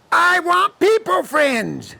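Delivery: put-on high, drawn-out childish wail; wailing little girl. Lonely girl turning down the bird, high drawn-out wail. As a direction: wail each word slowly, way up high.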